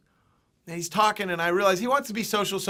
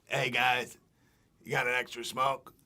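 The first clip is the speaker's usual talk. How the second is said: low, soft voiced stranger; stranger asking; 6 semitones lower, 5 dB quieter, slower than usual. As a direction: imitate a man quietly bumming a cigarette. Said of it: "this guy comes up and goes".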